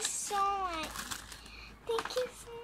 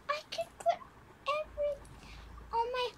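high, eager babble; excited chatter; 8 semitones higher, much faster than usual. Real, not acted. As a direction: gush about what fits inside. Still excited after squeal.

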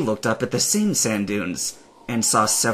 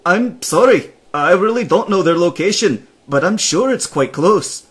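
high, loud, bright-eyed nomad voice; high and louder polite nomad; cheerful Nomad; 8 semitones higher, 6 dB louder than usual. Acted. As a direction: chirp it out like an eager helpful traveller.

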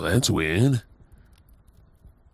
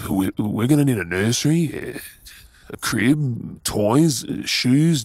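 ordinary talk

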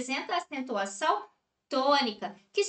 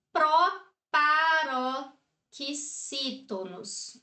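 slow spelled-out term; much slower than usual. Shown as a demonstration. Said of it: "teacher names word classes".

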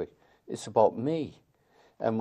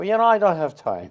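higher, louder brush-off; dismissive stranger; 11 semitones higher, 10 dB louder than usual. Retold or imitated. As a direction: shrug him off like a busy New Yorker. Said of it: "I said".

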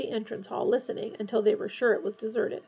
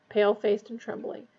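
plain reading voice

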